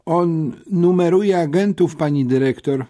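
high-pitched, eager-to-please pupil; usłużna uczennica. A narrator's reading. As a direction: tell on him helpfully to the headmistress. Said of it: "wyjaśniła usłużnie Nowicka". (usłużnie, wysokim głosem uczennicy)

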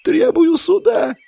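very high, slow wail; drawn-out falsetto whimper; whining. A narrator's reading. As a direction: whine desperately for a trial. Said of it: "заскулила женщина".

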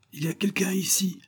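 acted cry of alert. A narrator's reading. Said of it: "cria le commissaire".

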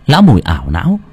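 usual speaking voice

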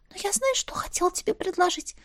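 high breathy whisper. A narrator's reading. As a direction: whisper it, not squeaking. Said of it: "прошептала, не рискуя пищать".

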